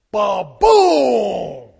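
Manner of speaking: a loud, booming shout of impact; a sudden loud blast of a shout. Retold or imitated. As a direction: shout it out like a cannon blast hitting. Shocked by the question.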